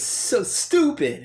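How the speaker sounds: loud, high, drawn-out mock outburst; acted self-berating. Film character hitting himself, acted self-berating. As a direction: stretch it slowly, loud and high.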